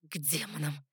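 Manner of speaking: low, quiet curse to herself; understated desperate muttered oath; desperate inner curse. A narrator's reading. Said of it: "отчаянно ругнулась я про себя".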